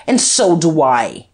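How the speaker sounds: loud, slow exclamation; emphatic loud retort; crying out. A narrator's reading. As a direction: cry it out, weighting each word. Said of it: "cried Guerchard".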